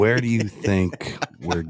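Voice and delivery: low, slow meat voice; real slow. Shown as a demonstration. Coaching the candidate on a border speech, slow deep demo. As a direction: draw the words out down low. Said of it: "do it real slow like this".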